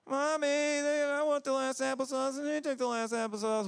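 put-on tattle like a squabbling child; whiny kid. Kids complaining to mom, high whiny tattle.

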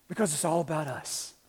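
ordinary talk

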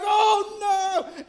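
high, loud cry of alarm; mock frightened shout; scared shout. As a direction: yelp it as the plane climbs. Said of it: "he's like".

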